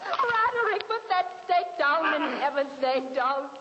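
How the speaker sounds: high, loud desperate begging; frantic pleading. Acted. Dina shrieking at Roderick to put down the stake. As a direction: stop him with panicked cries.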